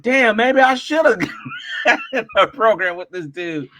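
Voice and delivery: half-shouted joke dissolving into laughs; laughing regret. Real, not acted. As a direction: crack up mid-sentence.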